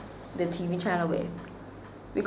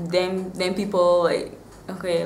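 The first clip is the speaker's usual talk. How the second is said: ordinary talk, nothing marked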